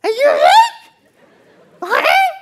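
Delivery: very high, very loud sarcastic yell; furious mocking shout. Angry bald driver yells back at the comedian who hit him. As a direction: scream the question back in a rage.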